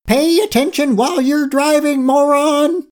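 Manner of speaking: very high, indignant yell; yelled scolding. A narrator's reading. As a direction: yell it high like a furious little creature. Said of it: "She yelled".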